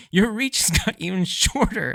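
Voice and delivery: Sanji yelling. Retold or imitated. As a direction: call out a taunt at an opponent. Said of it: "as Sanji yells at him".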